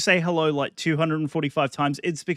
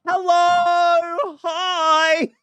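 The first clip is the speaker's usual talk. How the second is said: loud, high cartoon hello; high squeaky greeting; 12 semitones higher, 6 dB louder, much slower than usual.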